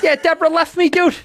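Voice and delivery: high, loud Boston-guy voice; Bill Burr. Acted. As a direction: pitch the breakup up high and loud. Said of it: "I'm doing my Burr".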